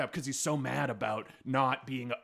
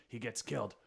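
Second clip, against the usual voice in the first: usual speaking voice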